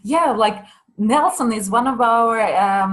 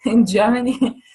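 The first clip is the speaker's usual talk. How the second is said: said through a laugh, louder; chuckling it out; laughing aloud at the joke; 4 semitones higher, 5 dB louder than usual. Real, not acted.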